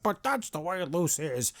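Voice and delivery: slow, soft mocking voice; whiny fan defense. Reviewer mimics fans excusing Luz, mocking. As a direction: mimic an objecting fan, drawn out. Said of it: "people will be like".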